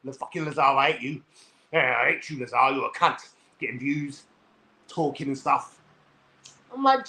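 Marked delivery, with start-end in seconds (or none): 0.0-5.8 low, grumbling mock-angry impression; imitated angry rival. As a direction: mimic his sulky anger in a lower voice. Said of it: "He gets angry at me".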